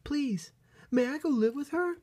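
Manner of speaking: very high, loud begging voice; pleading little bunny. Acted. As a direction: plead eagerly like a small bunny. Young bunny begs her mother.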